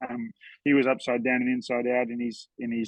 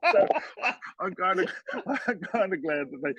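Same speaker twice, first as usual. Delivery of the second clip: laughing; 6 semitones higher than usual. Real, not acted. Laughing at own joke.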